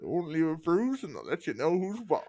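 mumbled around a cigar in mouth. Acted. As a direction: talk with a cigar clamped in mouth. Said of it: "I have the cigar in my mouth".

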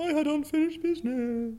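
high spooky ghost wail. Pretend. Playing ghost.

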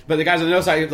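ordinary talk, nothing marked

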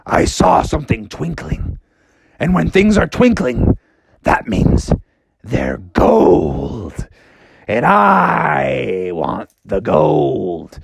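low, slow growly bear craving gold; greedy bear. Acted. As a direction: drag it out greedily like a sleepy bear. Bear wakes wanting gold.

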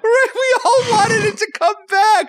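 high, laughing, half-crying; laughing mock wail. Real, not acted. Laughing, mock-wailing nostalgia.

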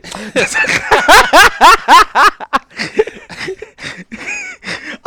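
high, sheepish laughter; embarrassed laugh. Real, not acted. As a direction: laugh off the slip. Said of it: "I'm sorry".

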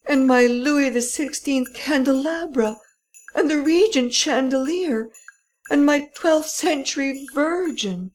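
high-pitched lament over losses; high, distraught cry of loss; despairing wail. A narrator's reading. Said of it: "in wildest despair".

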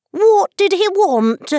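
high, loud, slow demand; a queen barking for an answer; high loud demanding question. Acted.

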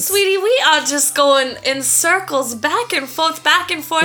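sugary Southern belle tourist; put-on Southern twang, sweet-talking a stranger; Southern woman accent. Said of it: "My accent is going to be a Southern woman".

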